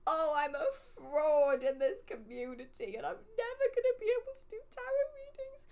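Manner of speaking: rising to a high, fast panicked squeal; panicked squeak. Retold or imitated. Retelling her panic at the reading, voice climbing into a squeal. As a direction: spiral up into a frantic wail.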